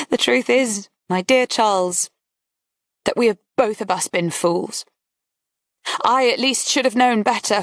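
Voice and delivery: a hint of a bored, mocking lady; bored society lady. A narrator's reading. Barbara voiced by narrator.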